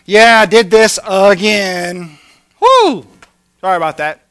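loud, drawn-out, careless confession with whoop; flippant apology. Acted. Sinner casually telling God he slipped, flippant. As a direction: whoop it up, shamelessly unrepentant. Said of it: "and say".

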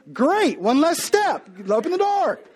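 mock eager husband; hopeful husband.

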